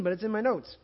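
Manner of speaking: normal talking voice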